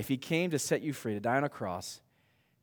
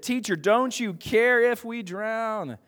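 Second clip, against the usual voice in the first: loud, high, drawn-out cry of alarm; panicked disciples; 8 semitones higher, 6 dB louder, slower than usual. Acted. Disciples waking Jesus in the storm, panicked cry. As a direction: wail it out slowly, high and loud.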